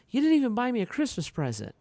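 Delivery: put-on high, quick childish complaint; son's complaint. Little son complaining to dad, high and fast. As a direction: rattle it off like an upset kid.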